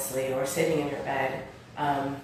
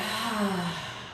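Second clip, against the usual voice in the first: higher, softer sigh on a falling "ha"; audible sigh; 4 semitones higher, 6 dB quieter than usual. Shown as a demonstration. Yoga teacher repeating the breath demo, audible sigh. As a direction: let all the breath out in a sigh. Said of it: "exhale everything out with an audible sigh".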